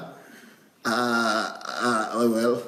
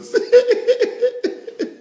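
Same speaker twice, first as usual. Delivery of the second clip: high-pitched airy burst of laughter; delighted giggle; 5 dB louder than usual. Real, not acted. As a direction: laugh off the comment warmly. Laughs after comment.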